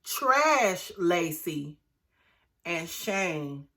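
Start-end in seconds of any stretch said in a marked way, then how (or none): 0.0-1.8 "Trash" drawn out slow and heavy before Lacey; stress on trash. Real, not acted. Vlogger correcting herself to brand Lacey, slow stress on "Trash". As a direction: lean hard on "Trash", slowly. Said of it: "excuse me".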